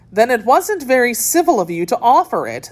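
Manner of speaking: understated storybook crossness; mildly angry. A narrator's reading. Alice rebukes the March Hare, mildly angry. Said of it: "said Alice angrily".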